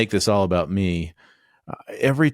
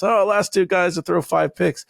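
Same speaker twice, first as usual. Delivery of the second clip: mock broadcasters; 10 semitones higher than usual. Mocking the TV announcers about five picks, hammed-up call. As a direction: ham up the TV booth. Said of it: "Tirico and Collinsworth throws Stafford".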